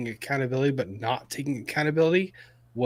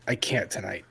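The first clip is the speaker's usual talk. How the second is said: whispered, unvoiced repeat; tired whisper; 4 semitones lower, 6 dB quieter than usual. Real, not acted. Co-host whispers that she can't face it tonight. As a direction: breathe it out, spent. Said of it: "repeated as whisper".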